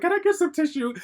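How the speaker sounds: choked-up high little voice begging the cashier; tearful squeak. Retold or imitated. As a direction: ask for tissue in a strained, teary squeak. Crying, asks McDonald's lady.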